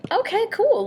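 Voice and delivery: high, loud upbeat exclamation; relieved. Retold or imitated. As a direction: pop it out high, as a relieved thought. Relieved thought after friend's reaction.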